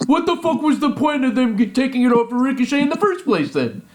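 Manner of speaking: high-pitched mock rant; Eric. Host predicts a colleague's complaint next week, high mock outrage. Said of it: "we're gonna listen to Eric next week".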